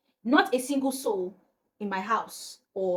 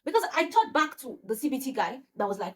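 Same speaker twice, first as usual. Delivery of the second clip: ordinary delivery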